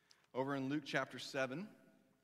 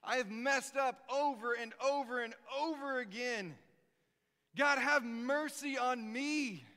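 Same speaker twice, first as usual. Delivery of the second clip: pleading sinner; 9 semitones higher, 5 dB louder than usual. Acted. Tax collector crying out to God, pleading loud and high. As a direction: beg God for mercy, voice straining high. Humble sinner's prayer acted out.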